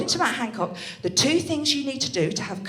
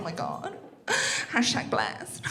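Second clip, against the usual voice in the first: dreamy whispered swoon; 8 dB quieter than usual. Retold or imitated. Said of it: "I was like".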